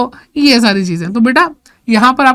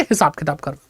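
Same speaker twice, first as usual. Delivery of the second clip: हिसाब किताब करना said again, lower and much softer; quiet repeat; 8 semitones lower, 7 dB quieter than usual. Shown as a demonstration. Teacher softly repeating the definition to students, quiet and lower. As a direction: drop the voice and let the definition sink in. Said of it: "अभी इतना ही समझो".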